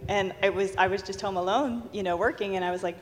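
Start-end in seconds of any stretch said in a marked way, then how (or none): none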